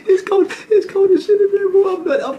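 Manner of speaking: squealing high with laughter; laughing. Real, not acted. Telling viewers about the cold cell, laughing hard.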